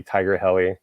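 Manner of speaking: ordinary delivery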